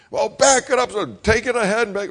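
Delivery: mock angry father; imitating impatient dad. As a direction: bark it like an irritated father.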